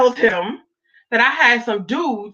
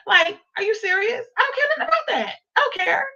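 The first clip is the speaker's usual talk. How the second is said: defiant outburst; 7 semitones higher than usual. Retold or imitated. Telling Derek his threats don't scare her, high and defiant. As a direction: refuse to be scared off.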